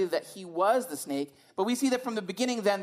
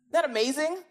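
ordinary delivery

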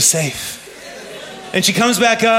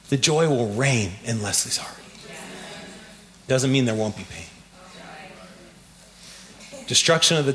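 low, soft solemn preaching; 8 semitones lower, 8 dB quieter than usual. Acted. Pastor preaching on the temple's loss, low and solemn.

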